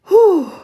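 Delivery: relieved whew. Retold or imitated. Brother comes to after fall.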